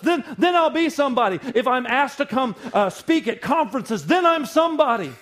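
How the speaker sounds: high, loud, laughing boastful pastor; hammed-up mock of a pastor chasing fame; mocking ambitious pastor. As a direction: lampoon a self-important preacher. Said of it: "a lot of people feel".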